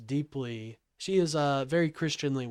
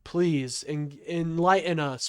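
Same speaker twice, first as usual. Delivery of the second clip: normal talking voice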